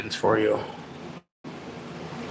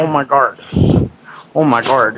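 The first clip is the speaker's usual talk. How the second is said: ordinary talk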